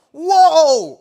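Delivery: a very high, very loud startled whoa; startled yell. Retold or imitated. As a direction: yell it as you leap over a snake. Jumps over snake in race.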